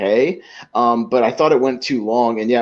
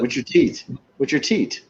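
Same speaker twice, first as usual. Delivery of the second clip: imitated foreign accent, slightly raised; Serge's accent; 3 semitones higher, 3 dB quieter than usual. Serge repeats himself to the baffled guest, foreign accent. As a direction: say it in a lightly mocked foreign accent. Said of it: "the way that he does the accent".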